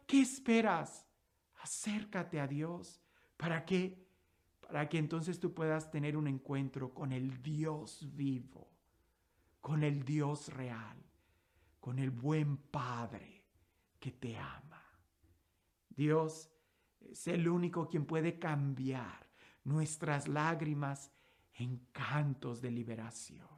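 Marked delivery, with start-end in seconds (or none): 1.6-13.4 tender. Acted. Cierre íntimo del sermón. 14.0-14.8 whispered, much softer; tender whisper. Acted. Cierre íntimo del sermón. 15.9-23.5 tender. Acted. Cierre íntimo del sermón.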